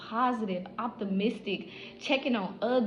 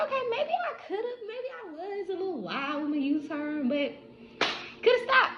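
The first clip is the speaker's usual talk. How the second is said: playful high comeback; 9 semitones higher than usual. Retold or imitated.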